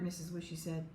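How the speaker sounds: usual speaking voice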